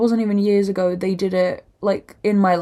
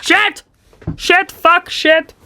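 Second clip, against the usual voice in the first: high, sharp yelps; panicked high-pitched cursing; real alarmed swearing; 10 semitones higher, 4 dB louder, much slower than usual. Real, not acted. Vlogger's lashes go wrong, real high yelped swearing. Mishap with eyelash glue.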